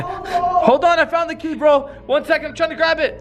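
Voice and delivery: high strained holler; urgent calling out. Acted. Telling a bro to hold on, urgent high call. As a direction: shout over to keep someone waiting. Shouting to friend while reaching.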